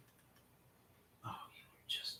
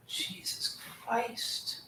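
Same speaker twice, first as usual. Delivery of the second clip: slow whispered curse, higher; exasperated whisper; 11 semitones higher, 12 dB quieter, much slower than usual. Real, not acted. Streamer at his frozen computer, slow exasperated whisper. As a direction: breathe it out in disbelief.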